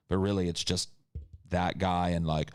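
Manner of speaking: usual speaking voice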